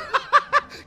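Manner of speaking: high, airy cackle; high-pitched laugh. Real, not acted. Player laughing at his own joke, high cackle.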